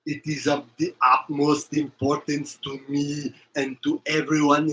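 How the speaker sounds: a mild Austrian action-hero lecture; hint of an Austrian bodybuilder warning about the boogeyman; Arnold Schwarzenegger. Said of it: "all the Arnold stuff".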